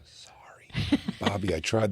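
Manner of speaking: whispered apology. Acted. Witness apologising to Bobby in court, whispered. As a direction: whisper it guiltily, barely voiced.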